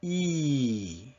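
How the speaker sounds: long held ee; steady drawn-out E vowel; sustained E. Shown as a demonstration. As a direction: stretch E out so the tongue position is felt. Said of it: "what your tongue is doing".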